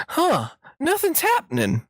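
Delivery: high-pitched granny fumbling with her computer; Southern old lady. Acted. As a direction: play dumb like a sweet old grandma. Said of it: "pretended like I was a, just a Southern idiot".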